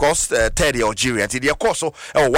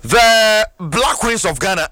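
higher, louder and drawn out slowly; proud announcement; 7 semitones higher, 4 dB louder, much slower than usual. Real, not acted. Announces the team name proudly.

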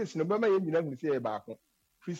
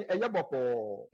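drawn-out reply; 7 semitones lower than usual. Retold or imitated. Speaker imitating a Ghanaian abroad answering a greeting, slow and drawn out. Repeats the laid-back reply, stretched.

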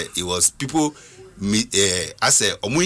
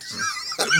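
shrieking laugh; 5 dB quieter than usual. Real, not acted. Burst of laughter on air.

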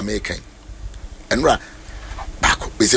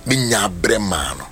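ordinary talk, nothing marked

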